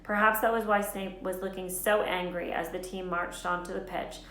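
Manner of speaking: usual speaking voice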